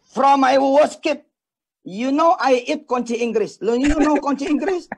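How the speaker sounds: slow, high, loud Indonesian-accented English; broken English show-off. Shown as a demonstration. Showing off English to the audience, hammed-up Indonesian accent. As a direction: ham up a proud speech in broken English. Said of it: "langsung aja ngomong bahasa Inggris".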